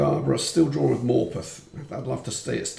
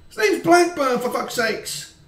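angry rant; 12 semitones higher, 5 dB louder than usual. Real, not acted. Raging at the player.

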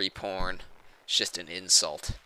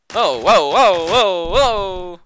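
loud, rising string of whoas; alarmed whoas; 8 semitones higher, 11 dB louder, slower than usual. Real, not acted. Player nearly hit in game, loud alarm.

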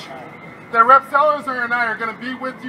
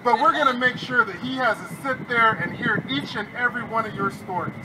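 normal talking voice

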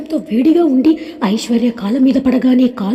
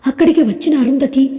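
ordinary delivery

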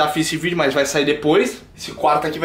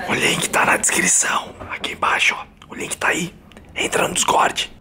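whispering. Acted. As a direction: whisper the plug. Whispered channel plug.

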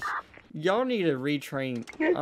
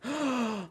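sharp gasp; 8 semitones higher, 5 dB quieter than usual. Real, not acted. Seeing the gun.

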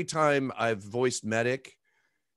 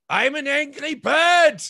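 high, loud and slow mock rant; angry bird Medic; 10 dB louder, slower than usual. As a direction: ham up a furious bird-man. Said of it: "we were saying things like".

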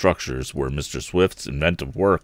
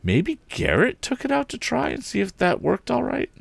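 hopeful. A narrator's reading. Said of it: "he said hopefully".

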